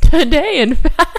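loud, high, laughing through the words; laughing tease. Real, not acted. Co-host teasing about today's astronomy mistake, laughing.

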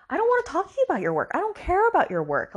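very high-pitched, fast dismissive retort; imitating an exasperated woman brushing someone off; mock exasperation. Acted angry brush-off in retelling.

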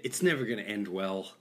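usual speaking voice

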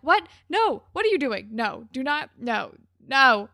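punchy, incredulous outburst building to a loud final no; shocked volley of refusals; appalled disbelief. Retold or imitated. Both hosts' outraged reaction, acted.

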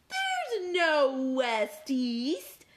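high, slow correction; interrupting Cat. Acted. Cat interrupting Fork, high and slow. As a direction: stretch it out, cutting in. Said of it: "interrupted Cat".

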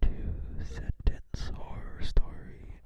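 slow eerie whisper; spooky whisper. Acted. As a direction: drag it out in a whisper. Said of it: "don't read it like that".

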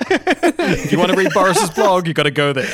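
laughing through a promo; words spilling out amid laughter. Real, not acted. Laughing ad plug.

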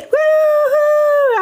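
high joyful whoop; celebratory woo-hoo. Real, not acted. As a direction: whoop with triumph. Celebrating finishing race.

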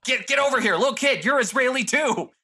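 high-pitched, mock summoning of a kid; mock bossy director. Mimics filmmakers bossing cast around.

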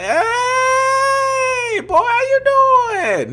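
shrieked very high, long drawn-out fake-cheery hello; over-the-top greeting. Mocking an exaggerated greeting to a narcissist ex, shrill hammed-up cheer. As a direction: ham up an awkwardly gushing greeting. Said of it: "That's awkward. That's even worse".

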